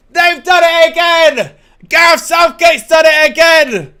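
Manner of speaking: high, loud victory shout; excited shout. Real, not acted. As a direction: yell it out in triumph. Cheering the England win.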